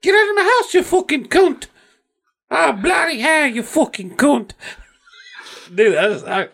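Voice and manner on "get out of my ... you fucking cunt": high squawking British insult; cartoon British name-calling; mock British. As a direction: hurl it in a falsetto British accent. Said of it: "how liberal they are".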